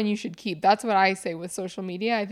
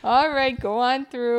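louder friendly Canadian drawl; Canadian officer accent; 4 semitones higher, 5 dB louder, slower than usual. Retold or imitated. Canadian border officer waving traveller through, Canadian accent. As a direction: wave them through like a Canadian guard. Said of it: "They're like".

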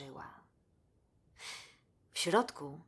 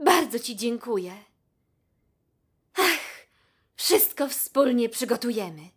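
higher, louder grateful giraffe voice; moved and overjoyed; 5 semitones higher, 8 dB louder than usual. A narrator's reading. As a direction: pour out thanks and plans with joy. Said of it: "wzruszona Bibi". (wzruszona i rozradowana żyrafa dziękuje, głośniej)

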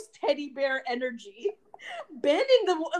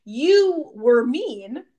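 slow; 4 dB louder, slower than usual. Retold or imitated. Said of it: "Instead of being like".

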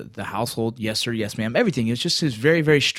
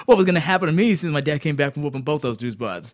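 ordinary talk

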